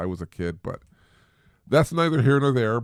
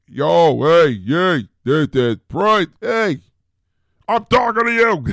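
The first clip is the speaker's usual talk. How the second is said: much higher, louder hammed-up yell; mock yelling; 8 semitones higher, 8 dB louder, slower than usual. Shown as a demonstration. Parent showing kids a rude phone call, mock yelling at Brian. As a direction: holler down the line at Brian. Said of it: "You don't wanna be yelling".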